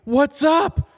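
high, loud called-out hello; excited greeting. Retold or imitated.

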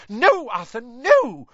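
wife shrieking at her brawling husband to stop. Acted. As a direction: scream at him to stop. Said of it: "Missus Weasley was shrieking".

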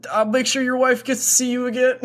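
high, earnest in-character medic voice; calm a wounded buddy, hammed up; reassuring medic. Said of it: "I will be like".

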